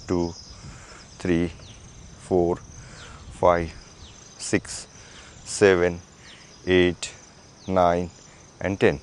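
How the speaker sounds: low, level rep count; exercise counting. Acted. Instructor counting shoulder rotations, steady low count. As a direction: count evenly, one word per movement.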